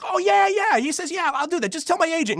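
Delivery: high, bright yes yes; eager agreement. Retold or imitated. Sean Astin agreeing in the hallway, eager. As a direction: toss off the agreement cheerfully.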